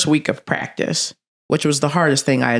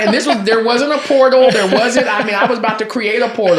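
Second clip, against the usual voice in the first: laughing; 6 semitones higher, 5 dB louder than usual. Real, not acted. Laughing while retelling escape plan.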